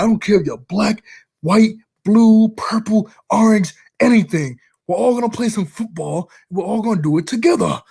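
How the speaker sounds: high, heated coach speech; hammed-up coach rallying the team; coach pep talk. Coach speech retold again.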